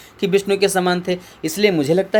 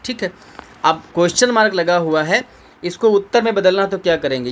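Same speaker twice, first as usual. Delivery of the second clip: normal talking voice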